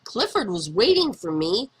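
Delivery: usual speaking voice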